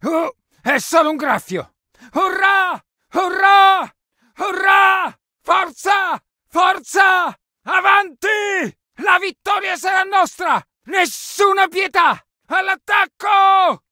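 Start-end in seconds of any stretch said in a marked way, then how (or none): 2.1-13.8 full-voiced rallying yell; battle cry. Soldier leads squad into attack, shouting battle cries. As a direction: whip the troops into a charge.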